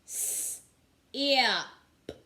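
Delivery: s whispered, then ip voiced; segmenting sounds. Shown as a demonstration. Teacher segmenting sip for young pupils, s hissed unvoiced. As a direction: split sip into s and ip.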